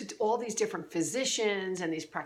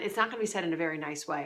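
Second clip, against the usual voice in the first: usual speaking voice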